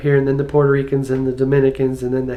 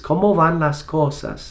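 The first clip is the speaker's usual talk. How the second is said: Caribbean Spanish. Shown as a demonstration. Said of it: "they don't pronounce their S's".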